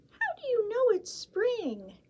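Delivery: high squeaky little mouse; high, bright little mouse voice. Acted. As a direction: play the tiny excited mouse.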